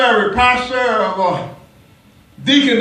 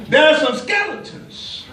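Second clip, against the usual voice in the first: preaching at full force; 4 semitones higher than usual. Acted. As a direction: drive it home to the congregation.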